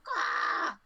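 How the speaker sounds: high, squawky bird quack. Acted. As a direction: honk it like an annoyed bird. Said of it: "birds on top of the caravan start going".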